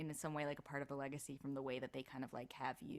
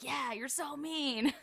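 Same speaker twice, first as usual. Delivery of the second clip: imitating delighted directors, higher, louder and drawn out; gleeful praise; 10 semitones higher, 5 dB louder, much slower than usual. Directors egging on a young voice actor, gleeful praise. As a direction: stretch it out high, cheering the meanness on.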